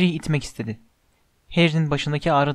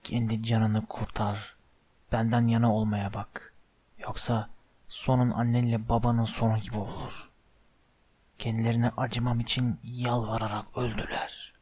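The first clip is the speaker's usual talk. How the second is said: low, hushed, menacing voice; sinister whispering villain; 3 semitones lower, 8 dB quieter than usual. A narrator's reading. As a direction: murmur it darkly, barely above a whisper. (alçak, fısıltılı, tehditkâr ses)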